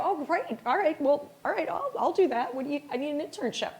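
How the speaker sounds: high-pitched, eager student patter; imitating an over-keen student; eager reply. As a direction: gush agreeably, then hurry into the request. Eager student acting out reply to recruiter.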